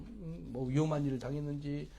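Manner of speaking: normal talking voice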